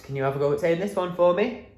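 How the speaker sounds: usual speaking voice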